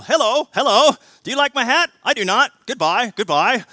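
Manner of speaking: high, loud, chirpy storybook dog; squeaky cartoon dog greeting; Go Dog Go voices. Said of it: "the female dog and the male dog come up and you go".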